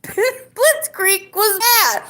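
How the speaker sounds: very high, squeaky drawn-out mock British; British accent. Shown as a demonstration. Streamer retrying the British line, squeaky and laughing. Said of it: "do my best British accent".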